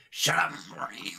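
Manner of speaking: overdone, smeared cartoon croak; hammed-up mad-scientist voice; exaggerated Rick. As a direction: slur it into a mess in character. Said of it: "I love exaggerating. So it's like".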